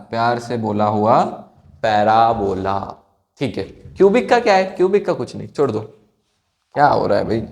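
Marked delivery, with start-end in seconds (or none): 0.1-2.8 slow sing-song "प्यार से बोला हुआ" blending into "parabola"; drawn-out mnemonic. Shown as a demonstration. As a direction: stretch each word so the class repeats it. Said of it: "बोलो मेरे साथ". (धीरे, सुर में "प्यार से बोला हुआ... पैराबोला")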